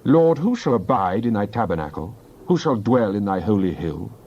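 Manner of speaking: normal talking voice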